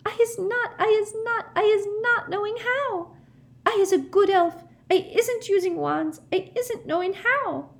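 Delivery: very high, squeaky panicked pleading; terrified house-elf. A narrator's reading. Winky begging the Ministry wizards, high terrified squeak. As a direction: babble in terror. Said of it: "her eyes rolling in terror".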